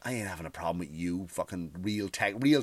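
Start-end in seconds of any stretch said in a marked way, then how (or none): none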